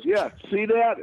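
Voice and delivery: a hint of a smug young guy; proud fan. Fan showing hickey.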